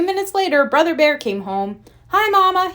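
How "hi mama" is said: put-on high young boy calling out. Brother calls to Mama on coming home, high. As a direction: call it across the house, bright and high. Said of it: "he called".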